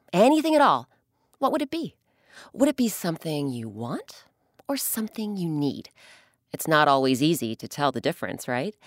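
plain reading voice

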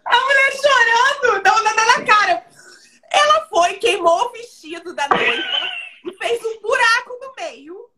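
high, loud, incredulous outcry; high-pitched loud storytelling panic; excited disaster retelling. Real, not acted. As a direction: shout it out, drawing out the disaster.

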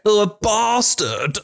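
high, drawn-out mimicry of an accent; mock the long vowel, slowly and high; mocking imitation. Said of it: "And they were like".

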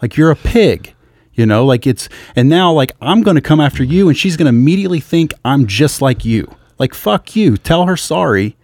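loud, high, raging threats at a man; furious retold yelling. Retelling blowing up at a rude man, furious yelling. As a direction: blow up and yell it at him full force. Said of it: "and then I finally blow up".

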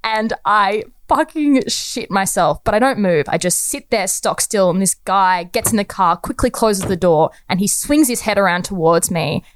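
plain reading voice

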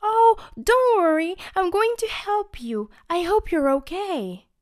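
high, loud eager toothbrush; Chubby voice. Acted. Chubby eagerly helping the candy girl. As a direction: reassure her eagerly.

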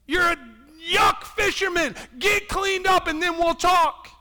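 loud, high, pushy rebuke; hammed-up harsh Jesus; mock scolding Jesus. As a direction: bark it like a preacher scolding a sinner. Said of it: "Jesus didn't come and say".

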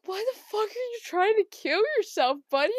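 high-pitched mock bewilderment; exasperated pleading. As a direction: plead with a pet in squeaky disbelief. Pleads with the falling spider.

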